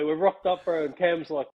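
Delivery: normal talking voice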